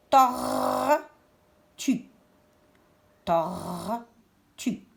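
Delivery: "Tortue" split, with a long R closing tor; syllable by syllable. Shown as a demonstration. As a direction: hold the R in "Tortue", pause, then tue. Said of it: "Quelle syllabe j'entends le R?". (« Tortue » en deux syllabes séparées, R prolongé)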